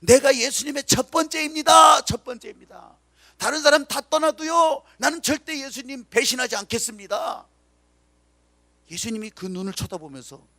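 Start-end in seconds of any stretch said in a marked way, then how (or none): 0.0-7.5 loud, proud shout of loyalty; boastful Peter. Acted. Boastful Peter vows loyalty to Jesus, shouting proudly. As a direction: crow it out like a braggart disciple. 자기 자랑에 충만한 사람.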